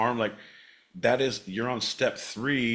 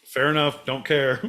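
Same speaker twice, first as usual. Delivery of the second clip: usual speaking voice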